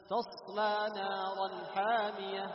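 chanted, ص in تصلى left to run; flowing ص. Shown as a demonstration. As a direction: recite it drawing out the ص of تصلى. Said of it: "شوف الصوت يجري معك". (ترتيل مع جريان الصاد في تصلى)

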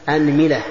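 "أنملة" said as anmila; opening a, middle i. Shown as a demonstration. Teacher lists variants for students, "أنملة" as anmila. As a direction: say "أنملة" with fatha on hamza, kasra on mim. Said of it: "هذا في الفتح".

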